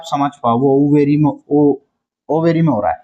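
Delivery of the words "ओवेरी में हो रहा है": ordinary talk, nothing marked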